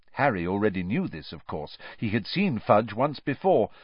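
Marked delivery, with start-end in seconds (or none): none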